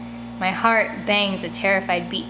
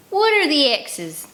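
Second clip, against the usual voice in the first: harsh demand; 7 semitones higher, 3 dB louder, slower than usual. Acted. Demanding about map.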